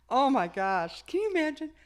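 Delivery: loud, high exclamation; exclaiming. Real, not acted. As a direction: cry out in amused disbelief.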